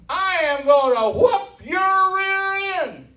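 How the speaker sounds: high, drawn-out mama hollering out the back door; hillbilly mama yelling. Retold or imitated. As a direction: West Virginia mom yelling for her son, high pitched and slow. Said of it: "she's yelling out the back door".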